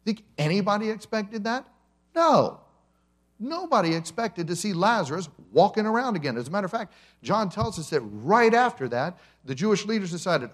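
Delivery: fervent preacher climax; preaching with force. Acted. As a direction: drive it home to the congregation. Preacher builds to emphatic peak.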